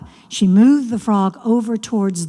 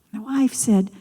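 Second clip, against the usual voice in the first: plain reading voice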